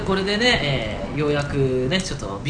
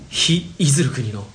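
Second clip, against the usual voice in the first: grand slogan delivery; slower than usual. Acted. Dramatic slogan in skit.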